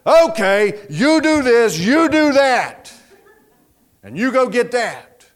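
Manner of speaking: acted leader giving kindly firm orders; loving but authoritative. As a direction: take charge and hand out jobs deliberately. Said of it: "with a loving but authoritative voice".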